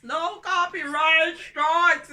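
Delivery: "No copyright strikes" said loud and slow, pitch up; drawn-out loud repeat. Shown as a demonstration. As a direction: stretch and belt the phrase "No copyright strikes".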